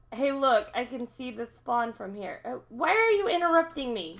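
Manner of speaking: normal talking voice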